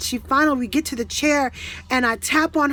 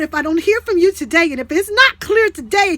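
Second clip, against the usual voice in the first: angry demand; 4 semitones higher, 6 dB louder than usual. Retold or imitated. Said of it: "and I'm telling God".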